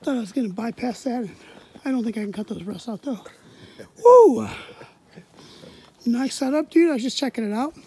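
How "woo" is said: loud high cheer; excited whoop. Real, not acted. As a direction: let out a big woo. Cheering outdoors.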